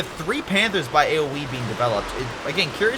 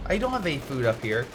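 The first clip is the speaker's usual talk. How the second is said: ordinary talk, nothing marked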